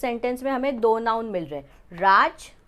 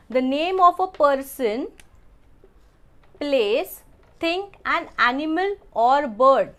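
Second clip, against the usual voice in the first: slow dictation; 2 semitones higher, much slower than usual. Shown as a demonstration. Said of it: "teacher reading definition slowly".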